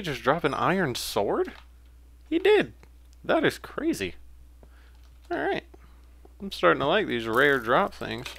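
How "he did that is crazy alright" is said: much higher, thrilled amazement; excited disbelief. Real, not acted. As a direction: gush over the lucky drop. Zombie dropped a rare sword.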